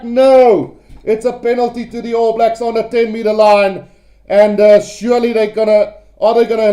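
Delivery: keyed-up rugby commentator in full flow; excited play-by-play. Real, not acted. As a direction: call the live action with rising urgency. Live rugby play-by-play at pace.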